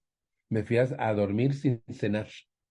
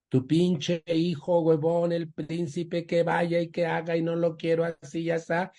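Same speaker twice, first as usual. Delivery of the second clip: aggressive father; 7 semitones higher than usual. Retold or imitated. Son mimicking his aggressive father ordering the lazy son. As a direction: bark it like the bullying dad. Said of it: "decía".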